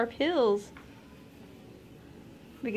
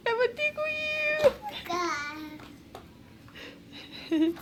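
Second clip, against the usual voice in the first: playful rising threat, much higher; giggly tickle attack; 10 semitones higher, 4 dB quieter, much faster than usual. Acted. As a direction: playfully warn the baby with a tickle. Mother tickling baby.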